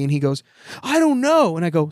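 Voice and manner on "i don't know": put-on much higher, whiny little-kid voice; sulky kid. Uncle retells nephew's tantrum, high whiny kid voice. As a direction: play the sulky five-year-old.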